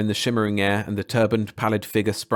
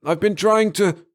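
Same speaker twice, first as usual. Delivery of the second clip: pleading; 10 semitones higher, 5 dB louder, slower than usual. Acted. Android pleading under attack.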